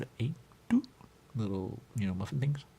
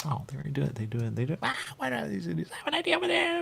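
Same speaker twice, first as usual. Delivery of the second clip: mocking voice; 9 semitones higher, faster than usual. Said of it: "you're gonna say".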